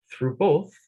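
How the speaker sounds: plain reading voice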